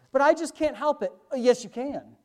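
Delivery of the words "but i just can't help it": higher, louder mock protest; mock excuse. Preacher mimics a believer who won't stop worrying, mock excuse. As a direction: plead helplessness in a raised voice.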